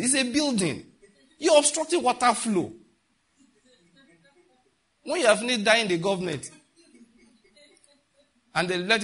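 high, loud rebuke to foolish Christians; retold loud rebuke of praying church. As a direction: preach it out hot and high, scolding. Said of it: "I said".

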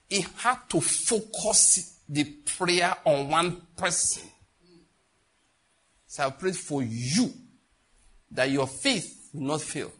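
slow emphasis. Acted. Slow emphatic scripture quote.

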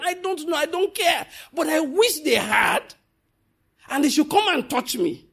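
high, bold defiant preaching; defiant challenge. Real, not acted. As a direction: dare them to try.

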